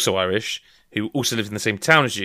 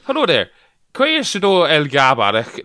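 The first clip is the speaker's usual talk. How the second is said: Irish attempt sliding into West Country, louder and slow; failed Irish accent; 3 semitones higher, 4 dB louder, slower than usual. Shown as a demonstration. Trying to read Irish words, botched Irish accent. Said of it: "all, all West Country".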